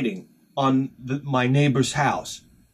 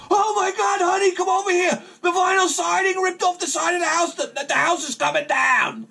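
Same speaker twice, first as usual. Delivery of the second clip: panicked screaming. Retold or imitated. Husband calling his wife over in a high panicked yell. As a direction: yell it like the house is falling. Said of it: "I'm screaming at the top of my".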